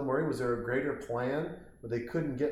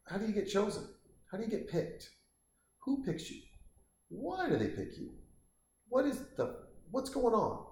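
baffled questioning; 9 semitones higher, 4 dB quieter than usual. Real, not acted.

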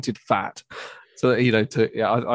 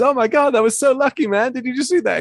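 much higher, louder gushing amazement; mock excitement; 13 semitones higher, 3 dB louder than usual. Band member gushing at the bird on camera, mock excited. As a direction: imitate a thrilled band member, hammed-up. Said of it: "he just goes".

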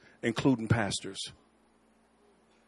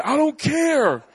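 stubborn reply; 10 semitones higher, much slower than usual. Retold or imitated. Son answers unbothered.

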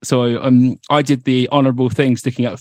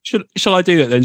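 usual speaking voice

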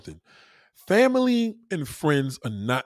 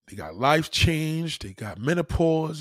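slow emphasis; 3 semitones higher, slower than usual. Real, not acted. Slowed emphatic list.

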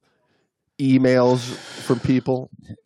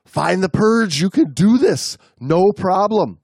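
higher-pitched inner pep talk; voice of reason; 7 semitones higher, 3 dB louder, slower than usual. A narrator's reading. Part of the diver's brain telling her what to do, encouraging voice. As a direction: read it as the brain urging itself on. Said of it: "Part of my brain was telling me".

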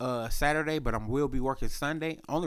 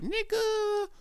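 much higher, mock exclaim; high pitched slang. Imitating himself, high squeaky exclaim. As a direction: jump the voice up high. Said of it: "And I'm like".

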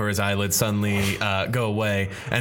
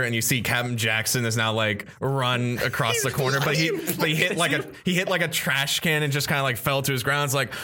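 ordinary talk, nothing marked

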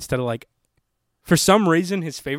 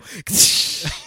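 mouth-made cymbal splash; imitated cymbal crash; 4 semitones higher, 4 dB louder than usual. Finishing the cat's drumming noise, cymbal crash. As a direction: end with a crashing cymbal hiss. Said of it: "And then it's like a cymbal".

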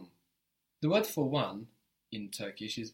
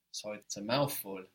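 ordinary talk, nothing marked

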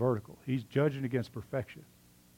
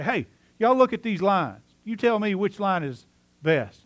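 higher, louder, slow call across the room; 8 semitones higher, 4 dB louder than usual. Retold or imitated. Kid calling classmates over to judge lines, loud. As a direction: summon the whole class to look.

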